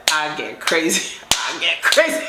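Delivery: slow, odd voice in the head; mock-crazy muttered thought; crazed inner voice. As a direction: act out your mind starting to lose it. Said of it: "And my head was like".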